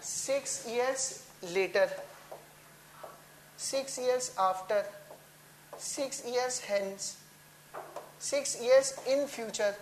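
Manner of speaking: very slow, each phrase drawn out for copying; slow, deliberate list read to students; slow dictation. Shown as a demonstration. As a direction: spell it out slowly so the class can write. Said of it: "future में क्या-क्या बोल सकते हैं?".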